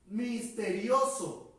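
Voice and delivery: misterioso said slowly and clearly; slow emphasis. Shown as a demonstration. Teacher giving the word to students, slow misterioso. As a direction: drag out misterioso for the class. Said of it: "teaching new vocabulary".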